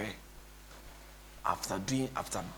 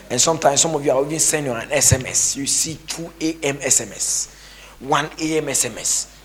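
higher, loud mocking rant; mock preacher rant; 5 semitones higher, 9 dB louder than usual. Pastor mocking prophets who text at dawn, loud. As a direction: ham up the preachy fuss.

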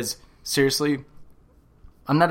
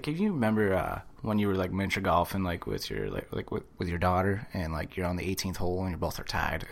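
low mumbled quote; 4 semitones lower, 8 dB quieter, faster than usual. Retold or imitated. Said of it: "he's like".

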